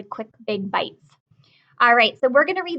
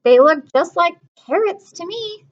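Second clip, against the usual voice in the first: fussy little sister; 6 semitones higher, 5 dB louder than usual. Acted. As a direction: pout like a picky little girl. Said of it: "says Lola".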